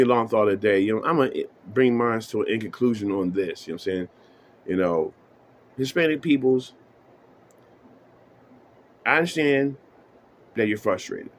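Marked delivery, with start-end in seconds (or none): none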